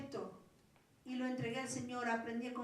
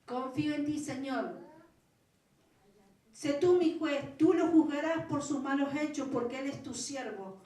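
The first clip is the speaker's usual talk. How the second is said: fervent retold prayer; 3 semitones higher, 7 dB louder than usual. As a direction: cry it out as a desperate prayer. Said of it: "Mire lo que decía".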